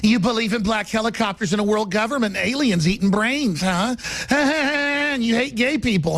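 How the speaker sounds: mock a trendy interviewer with a laugh. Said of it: "some trendy going".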